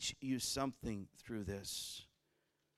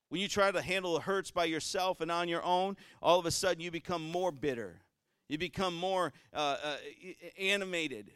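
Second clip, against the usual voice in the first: louder, higher preaching; sermon voice rising again; 5 semitones higher, 6 dB louder than usual. Acted. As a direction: push it out to the congregation. Preaching at full force.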